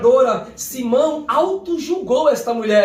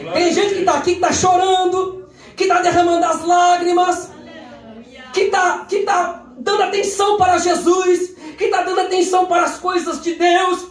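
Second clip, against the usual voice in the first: full-force preaching; 5 semitones higher than usual. Acted. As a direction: lift the congregation with a rising shout. Preacher building to a shout.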